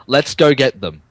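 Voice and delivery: normal talking voice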